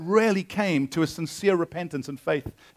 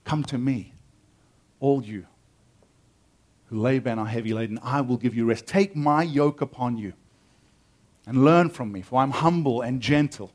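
gather them in gently; deep affection; 6 semitones lower than usual. Acted. Jesus turning to his disciples, with deep affection. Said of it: "with deep affection to his disciples".

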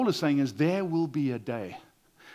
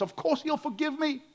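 high, breezy, carefree mocking quote; mock-casual presumption; 10 semitones higher, 3 dB quieter than usual. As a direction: toss it off lightly, mocking smug certainty.

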